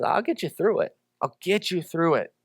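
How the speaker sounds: hushed, comforting repeat of the promise; soft reassurance. Acted. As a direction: say it gently, like a quiet promise. God's promise repeated softly.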